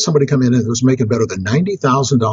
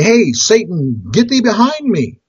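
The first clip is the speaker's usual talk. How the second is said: high, slow, theatrical rebuke; drawn-out acted command; rebuking the devil; 9 semitones higher, slower than usual. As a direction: cast Satan off in mock preacher style. Husband models rebuking the devil.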